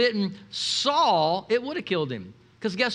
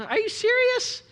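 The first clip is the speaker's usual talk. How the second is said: incredulous; 13 semitones higher than usual. Retold or imitated. Mock disbelief at friend.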